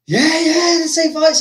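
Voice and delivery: high-pitched mock fan clamour; imitated crowd cheer. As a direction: shout for a fight like an eager crowd. Crowd answers star's question.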